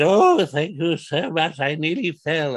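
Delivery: put-on high, slow, frail old man's thanks. Old man thanks Henry for catching him, frail and slow. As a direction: breathe out gratitude like a fragile old gent. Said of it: "he said".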